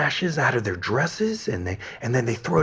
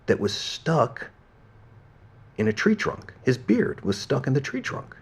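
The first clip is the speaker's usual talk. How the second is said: ordinary delivery, nothing marked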